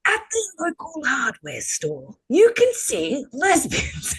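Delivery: slow, high wildlife narrator voice; nature documentary. Pretend. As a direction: narrate like [name] Attenborough. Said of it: "now it's time to do it, [name]".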